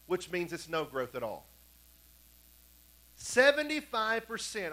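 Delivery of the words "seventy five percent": "Seventy-five percent" raised and slowly drawn out; "Seventy-five percent" spaced out with weight; emphatic repetition. Real, not acted. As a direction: drive home "Seventy-five percent", high and slow. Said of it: "I need you to get this in your head".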